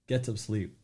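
ordinary delivery

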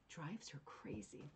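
soft, slow whisper; whispered aside. Real, not acted. Host confiding the daughter's annoyance, slow whisper. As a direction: breathe it out like a secret.

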